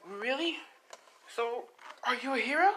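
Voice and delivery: put-on high, wide-eyed child voice; amazed young boy. Boy asking his rescuer about heroes, amazed.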